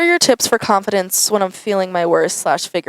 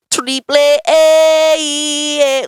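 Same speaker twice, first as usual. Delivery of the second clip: excited; 8 semitones higher, 5 dB louder, much slower than usual. Real, not acted. Gushing about favourite artist.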